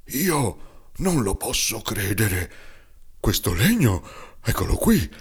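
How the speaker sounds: put-on a touch of old man's fright; lightly voiced frightened carpenter; trembling stammer. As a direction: stutter the first words, scared. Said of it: "tremando e balbettando dallo spavento".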